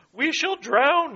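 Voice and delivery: high, slow, frightened wail of a drowning frog; hammed-up terrified frog; despairing cry. A narrator's reading. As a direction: cry it out like all hope is gone. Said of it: "he cried without more ado".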